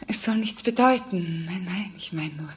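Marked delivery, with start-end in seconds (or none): 1.5-2.5 whispered, uneasy; fearful hushed whisper; anxious whisper. Acted. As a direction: whisper to calm yourself. Anxious daughter watches mother leave.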